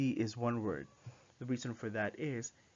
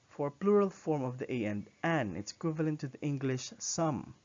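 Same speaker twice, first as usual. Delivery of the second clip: ordinary talk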